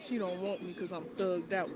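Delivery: low, soft mimicry of the guy; imitated rejected man. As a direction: lightly mimic him, dropping low and quiet. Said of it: "But then he was like".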